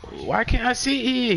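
high, slow pleading whine; mocking whiny voice. Friend retelling a guy begging for a seat, high whine. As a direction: whine it like a kid who wants the seat. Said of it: "He said".

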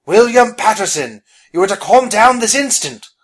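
loud, high, commanding rebuke; shouted scolding. Acted. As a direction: scold at full voice, easing slightly toward the end. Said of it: "Phineas shouted".

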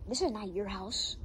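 ordinary delivery

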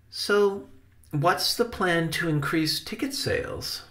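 high, light, curious Benny voice; high-voiced inquiring nephew; child-like questioner. Benny questions his uncle.

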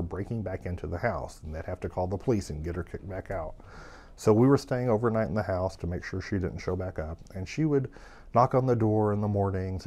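usual speaking voice

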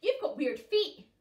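loud, slow nasty taunt. Acted. Puffin mocking the other's feet, loud and slow. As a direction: jeer at his feet.

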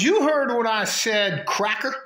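retold taunt. Woman insulting him at the credit union, retold taunt.

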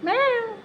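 high little mock cat meow; high playful meow back at the cat; imitated meow. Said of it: "कसला आवाज गोड आहे".

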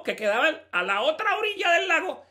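ordinary talk, nothing marked

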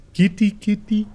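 high, sing-out kitty call; cat-calling voice. Pretend. Player looking for the pretty kitty cat, high coaxing call. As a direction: coax a cat out in a high little voice.

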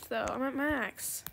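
ordinary delivery

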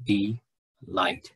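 Delight in two separate beats, de, then light; split into syllables. Shown as a demonstration. Teacher showing students the dictionary's breakdown, Delight split in two. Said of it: "breaks it apart into syllables".

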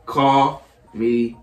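very slow, a pause between words. Real, not acted. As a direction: spell it out word by word. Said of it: "Say it again, slower".

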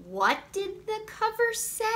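put-on high, childlike puppet voice; high Grover-style voice. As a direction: read it as a high, eager little monster. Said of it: "Starring lovable furry old Grover".

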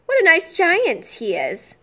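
slow, high and delighted; delighted girl. Acted. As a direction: savour it like a happy child. Lucy delighted by giant.

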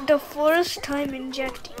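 usual speaking voice

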